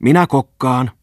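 put-on a girl claiming the bow seat; shouting. A narrator's reading. Mimmi calls out to the party, loud and eager. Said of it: "huusi Mimmi".

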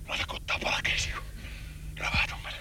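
hushed whisper so the hare won't hear. Real, not acted. Hunter whispering to his companion while sneaking close. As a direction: breathe the words, barely voiced.

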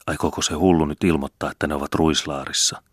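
ordinary delivery, nothing marked

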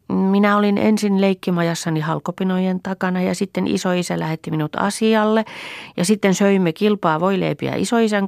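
put-on high, chattering small-girl storytelling; little girl's account. A narrator's reading. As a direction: recount the morning like an eager child. Child figure tells of morning.

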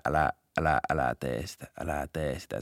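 imitating a nervous muttered please; quiet plea. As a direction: beg the suspect not to run, barely aloud. Pleading as suspect eyes exit.

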